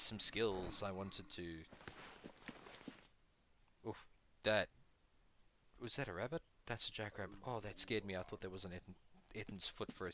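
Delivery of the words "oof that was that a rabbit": normal talking voice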